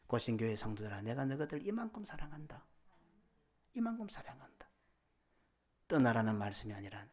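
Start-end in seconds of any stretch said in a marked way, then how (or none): 0.1-4.7 hushed, loving declaration, rising at the end; grieving tenderness. Acted. God telling the congregation of his love, hushed tenderness. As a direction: say it softly, as a heartfelt confession of love. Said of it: "비통한 말씀과 동시에 이 말씀은".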